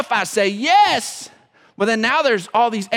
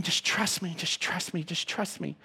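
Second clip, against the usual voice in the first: very soft, tender, lower reassurance; tender whisper-soft voice; 6 semitones lower, 12 dB quieter than usual. Retold or imitated. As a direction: coax gently like the Holy Spirit. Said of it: "so tenderly saying".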